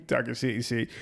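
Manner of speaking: repeating it through a laugh. Real, not acted. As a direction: laugh while saying it. Laughing repeat of quoted line.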